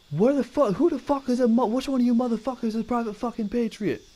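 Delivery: high-pitched, mock-furious sergeant bark; drill sergeant. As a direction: demand to know who it is, like a drill sergeant. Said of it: "the way I talk, the way things are said".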